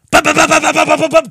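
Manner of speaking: loud rhythmic mouth-drum thuds; loud bum bum sound effect. Acted. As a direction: beat it out like thudding impacts. Vocal sound effect beat.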